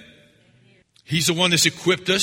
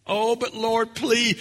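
mock begging; 6 semitones higher, slower than usual.

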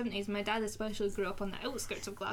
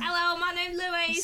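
slow, higher and louder Cockney voice; a slow comic Cockney introduction; impression of Louise; 7 semitones higher, 4 dB louder, slower than usual. [name] imitating her friend Louise in comic Cockney. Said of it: "you couldn't actually tell who was talking".

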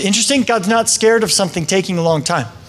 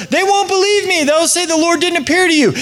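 much higher, complaining voice; whiny Moses; 10 semitones higher than usual. Retold or imitated. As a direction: whine your objection. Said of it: "Moses says".

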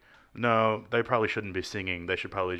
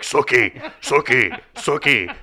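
vampire guy; 3 semitones higher, 6 dB louder, slower than usual. Acted. Co-host mimics TV vampire calling Sookie, vampire guy impression. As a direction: call the name out like a lovesick TV vampire. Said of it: "that one vampire guy's like".

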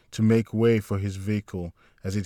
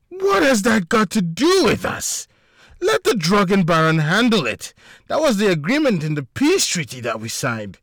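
high, loud dismissive retort; quick angry retort; 10 semitones higher, 4 dB louder than usual. A narrator's reading. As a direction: brush it off angrily. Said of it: "Mikael responded quickly".

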